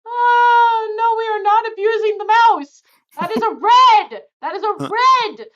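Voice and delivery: high, loud protest through laughter; helpless amusement. Real, not acted. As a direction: crack up while objecting. Cracked up by the barbarian joke.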